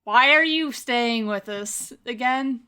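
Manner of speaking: normal talking voice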